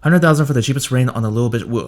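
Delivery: irritated teacher repeating; impatient. Shown as a demonstration. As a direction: rattle it off, losing patience. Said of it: "'cause I'm getting impatient".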